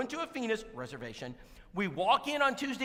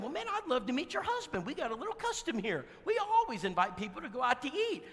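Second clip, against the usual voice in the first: high, sugary church-greeter welcome; gushing welcome; 8 semitones higher than usual. Retold or imitated. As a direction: lay the welcome on thick and high. Quoting his own invitation.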